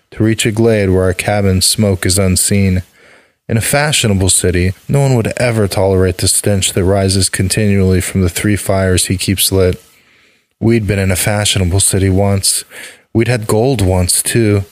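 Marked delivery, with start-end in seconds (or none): none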